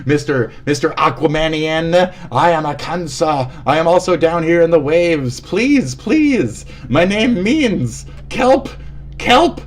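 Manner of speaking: high, stretched cries for mercy; mock desperate plea. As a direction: plead like a hammed-up drowning victim. Comic drowning plea for help.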